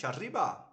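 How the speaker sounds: ARRIBA with a rolled Spanish R. Shown as a demonstration. Teacher offers Spanish as a model, rolled R. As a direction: trill the R in "arriba". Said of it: "like Spanish".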